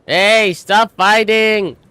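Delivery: high, loud, drawn-out call; urgent shout. Acted. Newcomer calling to people in a fight, urgent shout. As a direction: holler to break them up, stretching the words.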